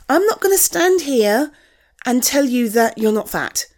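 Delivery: ordinary delivery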